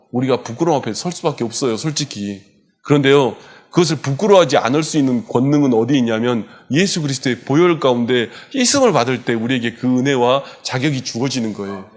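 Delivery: normal talking voice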